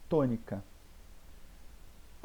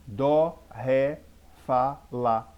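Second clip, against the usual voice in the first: "Dó", "ré", "fá", "lá" each loud and separate; tonic monosyllables; 6 dB louder, much slower than usual. Shown as a demonstration. Teacher listing musical notes as examples, stressing "Dó", "ré", "fá", "lá". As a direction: punch "Dó", "ré", "fá", "lá" one by one. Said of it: "são monossílabos tônicos que são acentuados".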